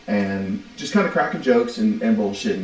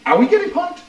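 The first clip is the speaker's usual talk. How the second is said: mock disbelief; 4 dB louder than usual. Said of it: "we're like".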